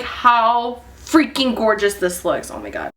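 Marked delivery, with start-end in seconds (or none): 2.5-2.9 much lower, much softer hush; breathed out in awe; awed whisper. Real, not acted. Vlogger hushed by how the highlight looks.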